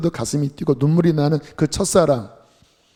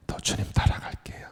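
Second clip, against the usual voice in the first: whispered promise; whispered vow; 7 dB quieter than usual. Retold or imitated. Brother vows to follow the Lord, whispering. Quoting a dying man's prayer.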